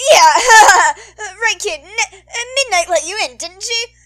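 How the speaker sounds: high, loud laughing cover; nervous laugh. Acted. Mike reassuring the kid, forced laughing.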